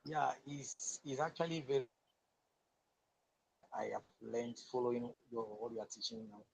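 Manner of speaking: faint, distant voice far from the mic; very quiet. Real, not acted. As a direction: speak softly, barely reaching the mic. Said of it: "Your voice is very low".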